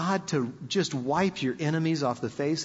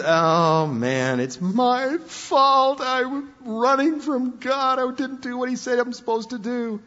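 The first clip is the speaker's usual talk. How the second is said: higher, slow, sheepish confession; guilty Jonah confessing; 7 semitones higher than usual. Retold or imitated. Jonah tells the sailors it's his fault, higher and sheepish. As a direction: own up like a caught kid. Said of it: "And Jonah says".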